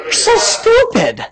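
high, drawn-out scornful taunt; mocking the devil. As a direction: stretch out the insult in a high voice. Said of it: "I tell the devil".

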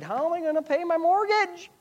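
high-pitched, exaggerated fretting; mock worry. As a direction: imitate a worrier wailing over bills. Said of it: "and you're worried".

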